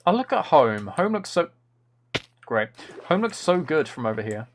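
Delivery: normal talking voice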